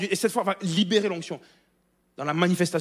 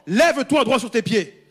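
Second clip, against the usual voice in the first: Paul's loud command, high and ringing; 6 semitones higher, 9 dB louder, slower than usual. Acted. Paul to the lame man, a loud ringing command. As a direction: order the lame man to his feet. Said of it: "Il dit d'une voix forte".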